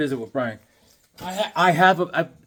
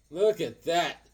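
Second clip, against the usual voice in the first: ordinary delivery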